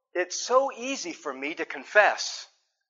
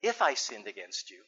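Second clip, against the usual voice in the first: wrong way to confess; 10 semitones lower, 9 dB quieter than usual. Shown as a demonstration. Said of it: "I don't say it this way".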